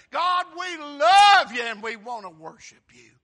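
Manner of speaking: a fervent worshipper's loud praise; loud adoring prayer. Pretend. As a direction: cry it out to God, full voice.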